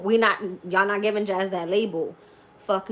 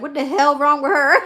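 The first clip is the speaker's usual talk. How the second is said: mock outraged neighbors; 7 semitones higher, 10 dB louder than usual. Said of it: "People are gonna be like".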